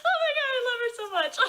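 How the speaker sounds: high, fast, giddy squeal through laughter; excited squeal. Retold or imitated. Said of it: "I'm like".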